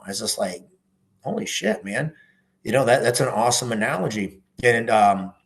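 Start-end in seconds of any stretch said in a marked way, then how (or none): 1.2-2.1 amazed. Retold or imitated. Retelling his reaction to an analogy.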